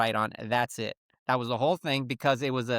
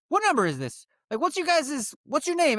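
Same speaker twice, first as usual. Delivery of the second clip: confused caller; 12 semitones higher than usual. Retold or imitated. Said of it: "people would be like".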